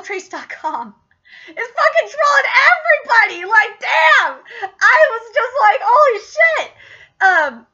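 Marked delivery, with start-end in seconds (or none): 1.5-6.7 loud, high, blown-away exclamation; amazed outburst. Real, not acted. Fan reacting to the trolling twist, loud amazement. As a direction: burst out in hyped disbelief.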